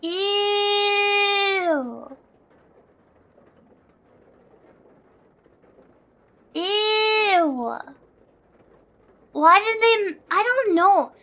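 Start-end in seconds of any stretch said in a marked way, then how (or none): 0.0-7.8 high, drawn-out grossed-out "ew"; disgusted. Real, not acted. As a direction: recoil at something ugly. Reacting to ugly character design.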